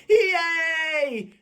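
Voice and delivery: a quick puppet shout of joy; excited cheer. Acted. Puppet Bookworm cheering for French Toast Day, excited yell. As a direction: cheer it out for the kids.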